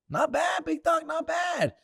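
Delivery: high-pitched, excited approval; pleased cheer. Real, not acted. Cheering the Lakers' five and two start, high and excited.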